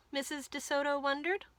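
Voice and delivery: usual speaking voice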